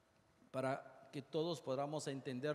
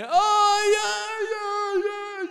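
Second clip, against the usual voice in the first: high, loud mock wails of pain; imitated wailing; 16 dB louder, much slower than usual. Lecturer mimics a man with renal colic, loud mock wailing. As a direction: howl like a big man with kidney stones.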